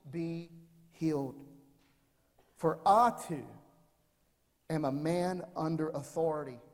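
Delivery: soft, low scripture reading. Acted. Pastor reading Matthew to the congregation, soft and hushed. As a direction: read the verse quietly to the congregation.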